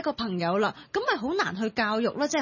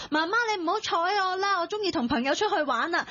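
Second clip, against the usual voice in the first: high-pitched mock teenage whining; imitated teenager; 7 semitones higher than usual. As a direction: playfully act out a teen brushing off mum. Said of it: "去到青春期嘅時候". (扮青春期仔女高聲撒扭)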